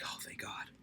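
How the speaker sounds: breathy whisper of relief; relieved whisper. Retold or imitated. Whispering the relief she felt when the President stopped. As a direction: whisper it like a held breath let go.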